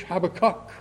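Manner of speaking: Habakkuk with its final syllable stressed; Hebrew-style Habakkuk, the weight at the very end; stress on last syllable. Shown as a demonstration. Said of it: "with the stress on the last syllable".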